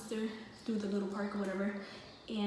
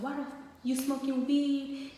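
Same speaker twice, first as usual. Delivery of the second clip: higher-pitched angry dad question; retold outburst, voice pitched up; imitated going off; 5 semitones higher, 3 dB louder than usual. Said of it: "he started going off".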